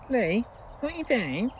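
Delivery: slow, high sing-song Lee what you doing; catchphrase drawl. Retold or imitated. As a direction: stretch the catchphrase out teasingly. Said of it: "who moans about me saying".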